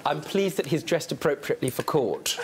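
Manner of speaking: normal talking voice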